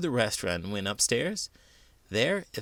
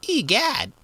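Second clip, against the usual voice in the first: much higher, louder shocked exclamation; startled cry; 14 semitones higher, 7 dB louder than usual. A narrator's reading. Jasper hears the coal talk, shocked and high. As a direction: yelp in amazement. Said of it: "cried Jasper".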